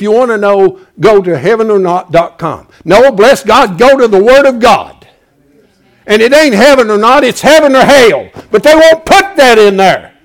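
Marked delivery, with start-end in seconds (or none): 2.8-10.1 loud pulpit shout; fervent preaching. Acted. Preacher denouncing a heaven-or-not ad, loud and fervent. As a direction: thunder it at the congregation.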